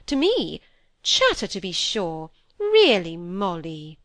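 affronted, emphatic reprimand; indignant elder sister. Acted. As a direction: scold a cheeky girl as her senior.

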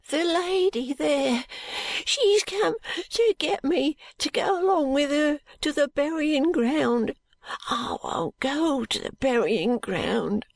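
put-on high, slow, drawn-out boy's voice; frightened sick boy. A narrator's reading. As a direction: plead high and slowly as a poor frightened boy. Frightened boy explains his fear.

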